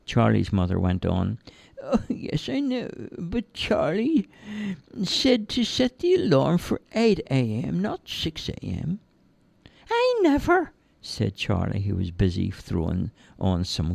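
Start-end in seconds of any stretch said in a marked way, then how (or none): 1.8-9.0 higher mother's voice, a touch breathy start; higher-pitched mum, patient; lightly voiced mum. Charlie's mum explaining. 9.9-10.7 put-on high, childlike simple lad voice; cheerful high-pitched lad; Charlie's voice. Charlie denying.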